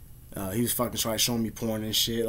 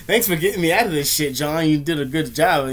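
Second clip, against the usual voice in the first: sarcastic thanks; 3 semitones higher, 6 dB louder than usual. Retold or imitated. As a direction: overplay the thanks to mock it. Sarcastic imagined thanks to John.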